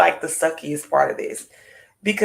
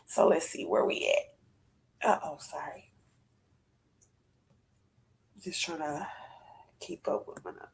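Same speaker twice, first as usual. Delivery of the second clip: quiet muttered aside; whispered aside; 10 dB quieter than usual. Real, not acted. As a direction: mutter it to yourself while reading notes. Muttering aside over notes.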